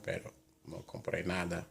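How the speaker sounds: ordinary talk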